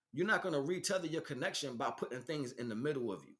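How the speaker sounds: ordinary delivery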